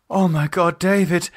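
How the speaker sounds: high, alarmed cry of his name; shocked woman. Acted. Lisa finding David slumped on the toilet, shocked.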